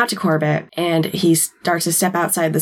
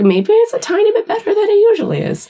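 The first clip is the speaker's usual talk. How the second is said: laughing hedge. Real, not acted.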